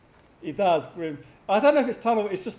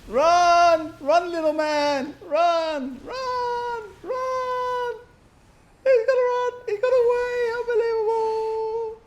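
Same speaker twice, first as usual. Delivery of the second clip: much higher, loud yelling "Run!"; excited shouting; 7 dB louder, much slower than usual. Real, not acted. Caster urging the fleeing unit on, shouting excitedly. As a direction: cheer him on as he escapes.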